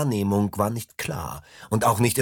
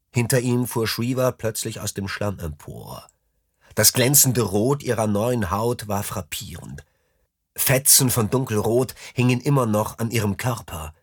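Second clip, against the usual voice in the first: ordinary delivery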